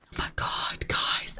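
breathless whisper of disbelief; awed whisper. Real, not acted. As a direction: whisper it, stunned.

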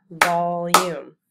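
"Volume" loud, stretched into two beats; loud syllable-by-syllable "Volume"; clapped syllables. Shown as a demonstration. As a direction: beat out both syllables of "Volume". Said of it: "Let's clap the syllables in volume".

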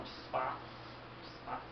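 soft, shy whisper; timid whisper. Acted. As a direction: barely voice it, shyly. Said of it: "Só, só isso que ele fala".

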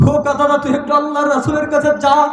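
high, loud chanted appeal; urgent high-pitched plea, easing at the very end; impassioned pleading. Acted. Voices pleading for intercession.

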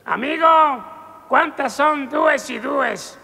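put-on high, slow drunken question. One drinker asking his friend a sum, high and slow. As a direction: ask slowly like a befuddled drunk.

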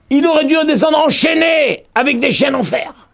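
preacher hammering home the chains; emphatic proclamation. Real, not acted. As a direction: proclaim it with full force. Stressing chains on Jacob.

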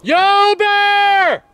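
very loud high yell; scaring off a bear. Real, not acted. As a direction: bellow it to scare off a bear. Calling out to warn bear.

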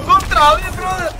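high, loud squeal of disbelief; excited disbelief. Real, not acted. Streamer to chat watching a rival's move again, high squeal. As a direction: shriek it like you can't believe it. Reacting to replayed play.

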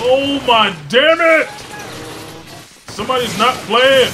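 loud, high cry of frustration; exasperated yell. Real, not acted. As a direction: yell in dismay as the attack hits. Gamer under heavy attack.

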